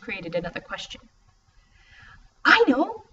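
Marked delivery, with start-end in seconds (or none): 2.4-3.0 high, loud fake excitement; mock enthusiasm. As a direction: pretend to have a brilliant idea. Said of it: "mock enthusiasm".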